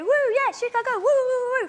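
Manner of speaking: mock ecstatic crowd cheer; wild crowd cheering. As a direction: whoop it up like a hyped crowd. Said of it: "They go".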